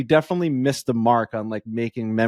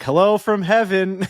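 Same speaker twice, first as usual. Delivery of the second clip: higher, slow, spooky-sweet hello; uncle's ghostly greeting; 6 semitones higher, 3 dB louder, slower than usual. Retold or imitated. Uncle pranking the family from heaven, slow and high. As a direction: play the dead uncle greeting from beyond. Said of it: "my uncle ... was like".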